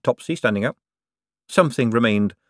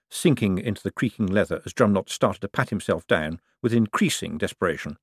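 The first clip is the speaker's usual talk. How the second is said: usual speaking voice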